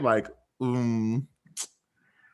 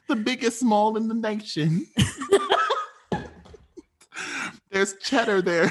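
high-pitched exaggerated Wisconsin accent; 10 semitones higher than usual. Friend testing the next regional accent, Wisconsin accent. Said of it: "Do we got a Wisconsin?".

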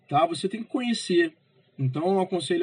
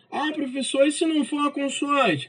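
higher-pitched student piping up; raised voice of a querying student; student's question; 8 semitones higher than usual. Acted. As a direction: play a pupil interrupting the teacher with a question.

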